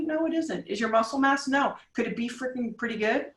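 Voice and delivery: ordinary talk, nothing marked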